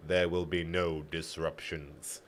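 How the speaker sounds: slow, low and softened warning; spoken slowly. A narrator's reading. Guard warns the prisoners in halting trade tongue, speaking slowly. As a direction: say each word slowly, low and quiet. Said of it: "he spoke slowly".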